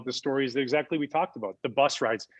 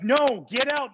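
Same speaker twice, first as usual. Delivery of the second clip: higher, louder retold yell; mean yelling; 9 semitones higher, 5 dB louder, slower than usual. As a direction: snap it like a panicked driver. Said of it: "meanly yelling at us".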